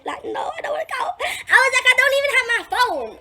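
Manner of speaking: half-laughing plea not to go; mock wailing protest. Begging not to go home.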